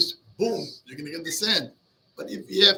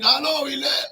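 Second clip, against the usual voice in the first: mock yell; 6 semitones higher, 6 dB louder, faster than usual. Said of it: "The guy is screaming".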